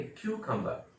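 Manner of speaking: क्यूकम्बर as kyoo-kam-bah; correct pronunciation. Shown as a demonstration. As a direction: open क्यूकम्बर on kyoo, no final r. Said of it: "हम लोग बोलेंगे".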